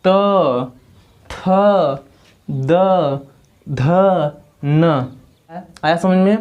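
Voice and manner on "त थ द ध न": त, थ, द, ध, न each said alone, tongue on the teeth; teacher demonstrating त थ द ध न; demo of dental sounds. Shown as a demonstration. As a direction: sound out त थ द ध न one by one. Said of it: "इसको आप लोग बोलिए".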